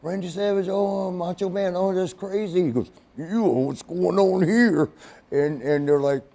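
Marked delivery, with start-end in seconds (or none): 0.0-2.7 high-pitched fan amazement; star-struck. Retold or imitated. Imitating star-struck police officers. 3.2-4.9 high, drawn-out wrestler voice; slow, stretched impression, pitched up; Macho Man. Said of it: "Macho Man was coming in".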